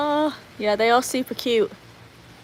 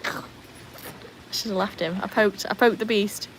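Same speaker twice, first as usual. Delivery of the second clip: ordinary talk, nothing marked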